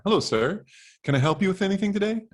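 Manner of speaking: high, bright-eager store clerk voice, quick; eager teen clerk. Acted. Teen clerk greeting a man in a wheelchair, high and fast. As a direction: chirp it out like an overhelpful teenager.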